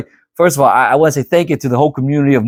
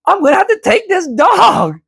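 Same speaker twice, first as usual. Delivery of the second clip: giving in to the dog. Retold or imitated. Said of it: "I'm like".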